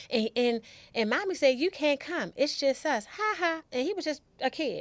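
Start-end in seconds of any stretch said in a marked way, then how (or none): 1.6-3.6 put-on childish taunt. Brother quoting mommy, taunting.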